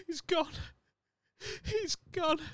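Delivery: high, gasping fake sobs; mock crying. Grieving film character over a dead loved one, mock crying. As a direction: act out a tearful, high-pitched grief. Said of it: "he's, like, crying".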